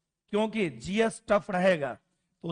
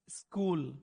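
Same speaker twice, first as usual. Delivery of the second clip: lower, softer स्कूल with Bihari i; Bihar accent; 4 semitones lower, 7 dB quieter, much slower than usual. Retold or imitated. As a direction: mumble स्कूल stubbornly, Bihari. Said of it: "वो ई जोड़ता है सब चीजों में".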